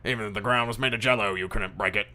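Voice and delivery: put-on voice of a scornful boss; irate police captain. Captain mocks his detective's work, scornful. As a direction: needle the useless subordinate. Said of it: "Jackson comes up to you and goes".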